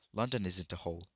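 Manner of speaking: ordinary delivery, nothing marked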